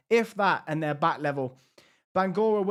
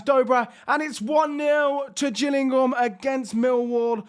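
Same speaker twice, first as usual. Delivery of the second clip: higher and louder, roaring the goal call; goal celebration shout; 7 semitones higher, 4 dB louder than usual. Real, not acted. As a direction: yell it like a commentator on a goal. Goal scored, commentating.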